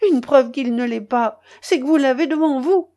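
high, loud triumphant rebuttal; emphatic exclamation. A narrator's reading. Old man reveals living son to mother, exclaiming. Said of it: "s'écria le père Dany".

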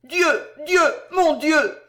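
high, loud cries to God; three overwhelmed outcries; crying out. A narrator's reading. Mother seeing her lost son, crying out loud. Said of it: "La femme cria trois fois".

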